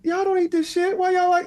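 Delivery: very high, strained mock indignation; mock scolding. Scolding his relatives for eating his casserole, mock outrage. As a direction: squeal it at the relatives.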